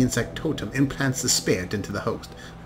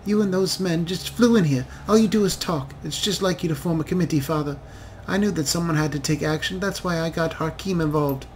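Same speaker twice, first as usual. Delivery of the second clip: higher, rising and insistent, reproaching her father; upset daughter; 6 semitones higher than usual. Acted. Daughter scolds her father for doing nothing, higher and heated. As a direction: pushes back hard against her father's talk.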